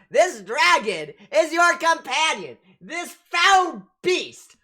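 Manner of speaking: loud, high, drawn-out denunciation; outraged game character. Acted. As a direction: denounce the beast in a slow, theatrical shout. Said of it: "looks at you and goes".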